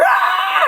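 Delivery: long high shriek; scream. Retold or imitated. Driver seeing a deer screams in fright. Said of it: "a lot of screaming".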